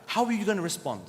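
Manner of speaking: ordinary talk, nothing marked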